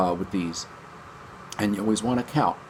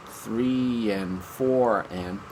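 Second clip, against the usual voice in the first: slow count; much slower than usual. Shown as a demonstration. Teacher demonstrates the quarter-note count slowly. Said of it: "but then on the quarter notes".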